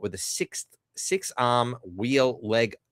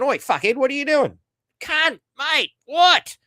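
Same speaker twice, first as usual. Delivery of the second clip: very high, put-on Australian yobbo holler; 13 semitones higher, 5 dB louder than usual. Australian supervisor swearing at a baffled robot, yobbo shout. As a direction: shout it in high-pitched Aussie slang. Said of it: "Australian English dialect".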